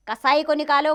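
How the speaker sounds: loud, high-pitched order; royal command. Acted. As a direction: order your guards out at once. King orders his guards.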